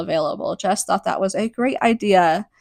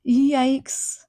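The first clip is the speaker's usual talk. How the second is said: high, cringing yikes; 7 semitones higher than usual. Real, not acted. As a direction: wince at the line. Reacting to the offensive line.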